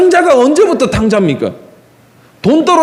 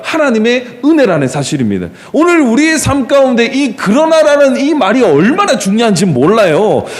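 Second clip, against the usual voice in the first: ordinary talk, nothing marked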